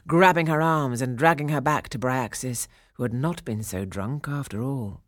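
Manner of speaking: ordinary delivery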